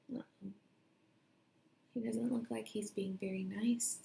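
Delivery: a hushed uh-oh, then a whispered aside; worried whisper. Acted. Storyteller aside to the children, whispered concern. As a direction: lean in and whisper the worry.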